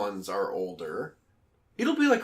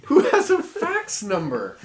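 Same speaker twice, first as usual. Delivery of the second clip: laughing disbelief; 12 semitones higher, 6 dB louder, slower than usual. Real, not acted. Laughing disbelief about fax numbers.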